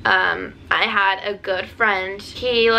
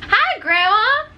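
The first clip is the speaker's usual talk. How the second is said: calling out; 13 semitones higher than usual. Real, not acted. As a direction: call out to grandma cheerfully. Calling out to grandma.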